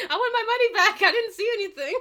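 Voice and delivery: loud, high, laughing protest; hammed-up squealed complaint; mock complaint. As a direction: wail it in fake outrage through laughter. Mock complaint about the film.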